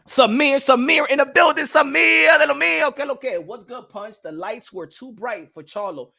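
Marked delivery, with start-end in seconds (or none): 0.1-3.4 loud, high hype call-out; hype shout. Real, not acted. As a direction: shout the name like an MC. Hyping a guest on stream.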